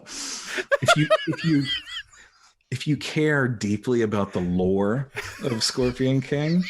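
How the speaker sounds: words broken by laughter; laughing while talking. Real, not acted. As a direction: laugh through the line. Laughs through the answer.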